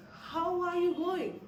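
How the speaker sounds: slow, fully separated words; clear, careful enunciation. Shown as a demonstration. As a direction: spell out each word of "How are you going" slowly. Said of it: "we'll just clearly say".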